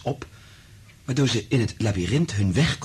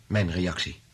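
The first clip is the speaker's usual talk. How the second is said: ordinary talk, nothing marked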